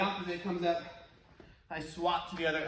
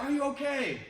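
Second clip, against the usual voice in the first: caring call out; 7 semitones higher than usual. Retold or imitated. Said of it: "shouted out they wanted to help".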